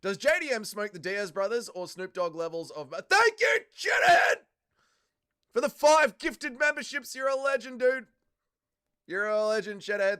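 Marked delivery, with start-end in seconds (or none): 3.1-7.1 high, very loud shouted gratitude; overjoyed high-pitched shout; excited thanks. Real, not acted. Thanking viewer for gifted memberships. 7.1-10.2 tag. Real, not acted. Still praising the gifting viewer.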